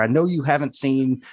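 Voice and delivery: normal talking voice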